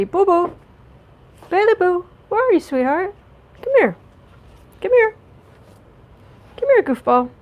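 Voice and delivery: sing-song call to a cat across the room; high pet-calling voice. Real, not acted. Owner calling her cat over in a high voice.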